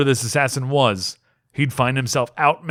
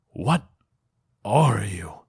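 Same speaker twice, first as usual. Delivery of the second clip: hoarse whisper; much slower than usual. A narrator's reading. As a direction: rasp out the question in a whisper. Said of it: "Gavilar whispered, hoarse".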